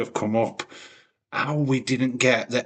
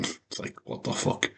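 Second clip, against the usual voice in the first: whispered; 9 dB quieter than usual. Real, not acted. Muttered reaction to booking.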